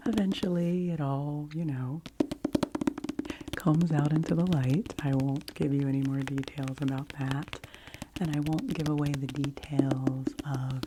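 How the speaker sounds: ordinary delivery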